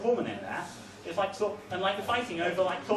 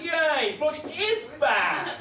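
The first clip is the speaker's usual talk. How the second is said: mock crowd cheer; 6 semitones higher, 3 dB louder, much slower than usual. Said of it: "everyone would go like".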